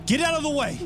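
high, loud warning yell; urgent shout. Acted. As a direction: bark the warning. Swinging extinguisher at oven.

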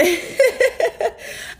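real laugh breaking out; high burst of laughter. Real, not acted. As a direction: cackle at your own words. Laughs at herself.

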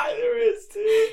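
high, loud laugh-cry, slowed down; words squeezed out through laughter. Real, not acted. Host laughs at guest's remark.

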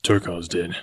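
low grim reply. A narrator's reading. Tarzan answers the girl about the kill, grimly. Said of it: "said Tarzan grimly".

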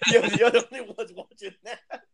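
laughing through the words. Real, not acted. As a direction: tease them while laughing loudly. Friends laughing at obscure show.

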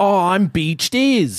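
slow, put-on Australian whale voice; a slow mock Australian whale voice; Beach Daz voice. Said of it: "there's that whole video".

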